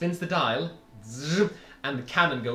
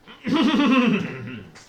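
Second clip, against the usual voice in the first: villain cough; 7 semitones higher, 5 dB louder than usual. Acted. Villain reveal in GM voice.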